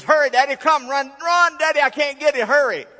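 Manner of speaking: put-on high, loud, pleading little girl yelling for help; frantic child. Second daughter begging dad to hurry, loud and frantic. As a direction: shout as a daughter who can't land the fish. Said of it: "When another voice says".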